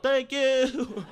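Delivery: high, loud, bright-eyed thanks; the kid thanking for the poop bag. Retold or imitated. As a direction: beam it out like a little kid. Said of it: "is like".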